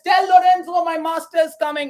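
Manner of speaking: high, loud hollered announcement; Lancelot yelling. Acted. Lancelot answers Lorenzo with his news, yelling. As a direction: shout the news out like a crier. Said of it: "He just yells out".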